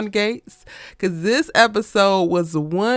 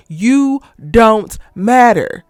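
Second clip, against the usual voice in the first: "You don't matter" very slow, each word weighted; emphatic word by word; 4 dB louder, much slower than usual. Acted. Telling the mistress her place, "You don't matter" slow and emphatic. Driving the verdict home to Haley.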